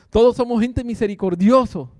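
normal talking voice

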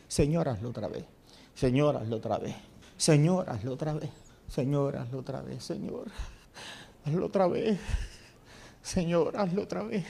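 low, hushed pleading that fades; soft repeated prayer. Acted. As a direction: breathe the prayer softly, over and over. Repeated daily personal prayer.

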